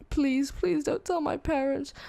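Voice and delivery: high, slow, whiny begging; tearful pleading. Retold or imitated. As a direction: imitate a friend tearing up. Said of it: "tearing up".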